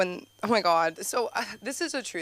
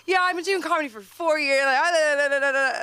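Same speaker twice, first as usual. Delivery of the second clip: high, loud, breaking into babble; hammed-up flustered outburst; 8 semitones higher, 6 dB louder, faster than usual. Comic imagining an awkward tearful introduction, hammed-up outburst. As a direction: blurt it in a mock meltdown.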